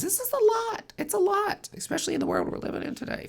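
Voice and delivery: high and strained, near tears; the weight of it all breaking through; overwhelmed. Real, not acted. Reader overwhelmed by the state of the world, high strained voice. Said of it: "being gentle with yourself".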